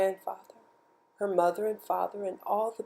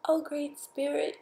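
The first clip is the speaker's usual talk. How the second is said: put-on little girl's voice; 8 semitones higher than usual. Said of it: "she who was alone said".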